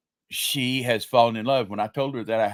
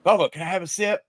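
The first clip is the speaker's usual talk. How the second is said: put-on high, quick little girl asking; 8 semitones higher, 4 dB louder, faster than usual. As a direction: sweetly ask like a small granddaughter. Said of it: "and she said".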